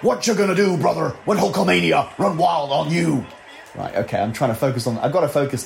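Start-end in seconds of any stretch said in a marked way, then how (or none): 0.0-3.2 Hulk Hogan impression. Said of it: "Or Hulk Hogan".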